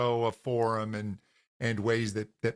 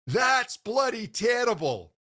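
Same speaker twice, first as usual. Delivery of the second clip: dad's high-pitched Scottish outburst; imitating a blunt Scottish dad; Scottish accent; 9 semitones higher than usual. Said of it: "That was a great accent".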